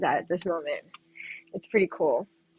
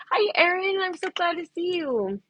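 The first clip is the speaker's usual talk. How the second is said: high, cheery hello; excited welcome to a viewer; bright greeting; 9 semitones higher than usual. Real, not acted.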